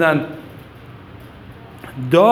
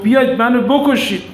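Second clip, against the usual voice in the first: higher-pitched, slightly raised challenge; retold battle challenge; 8 semitones higher, 4 dB louder than usual. Warrior Abes calling out to enemies who won't fight, retold defiant call. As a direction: dare them to come, voice lifted.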